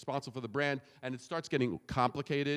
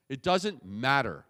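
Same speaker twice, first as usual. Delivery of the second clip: usual speaking voice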